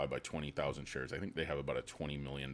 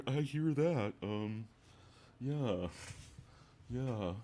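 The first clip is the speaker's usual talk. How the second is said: pitched-up mock reply; 7 semitones higher, much slower than usual. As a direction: say it in a put-on voice. Mock voice continues.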